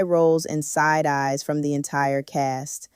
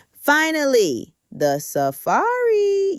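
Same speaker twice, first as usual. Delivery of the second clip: drawn out and much higher, slow; excited announcement; 11 semitones higher, much slower than usual. Acted. Hyping the viewers for the safari, slow excited rise. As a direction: stretch "the safari" out like a big reveal.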